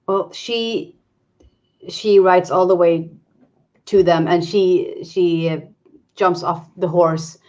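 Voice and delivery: ordinary delivery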